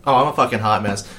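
ordinary talk

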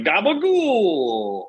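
drawn-out, very slow Jersey "Gabagool"; Sopranos Jersey accent. Retold or imitated. Joking about Jersey, stretching "Gabagool" in a mobster accent. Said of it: "New Jersey's like The Sopranos".

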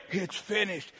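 soft, low, feeble utterance; weak, defeated. Shown as a demonstration. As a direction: let it drop out quietly, lifelessly. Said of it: "He didn't say".

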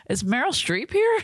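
high excited gasp of a question; mock amazement. Joking about a hotel audition, mock starstruck. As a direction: pretend starstruck, pitch jumping up.

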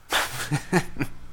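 short soft unvoiced chuckle; soft breathy laugh. Real, not acted. Laughing at himself for being an asshole, a soft chuckle. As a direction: let out a quiet breathy laugh.

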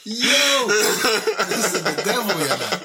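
high, loud, laughing yell; laughing shout. Real, not acted. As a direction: shout it while cracking up.

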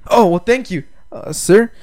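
imitating an awkward, overeager thanks. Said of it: "he just did it so awkward".

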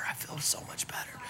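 soft unvoiced whisper, barely there; faint whispered relief. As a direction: breathe it out weakly. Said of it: "she's saying it in a whisper".

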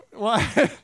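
ordinary talk, nothing marked

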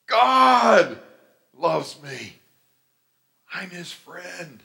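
loud, high shout falling away; exultant shout. Real, not acted. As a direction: cry it out with joy. Rejoicing at being reconciled.

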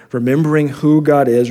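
ordinary delivery

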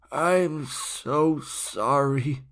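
very slow, faint apology from a dying man; dying old man's apology. A narrator's reading. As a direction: drag the words out as if fading.

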